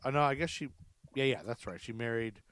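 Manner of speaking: ordinary talk, nothing marked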